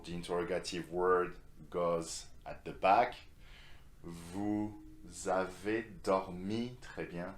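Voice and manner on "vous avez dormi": slow, piece by piece. Shown as a demonstration. Teacher assembling the sentence for learners, slow and separated. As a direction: pause after "Vous", then stretch "avez dormi".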